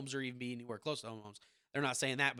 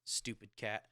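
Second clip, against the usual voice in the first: ordinary talk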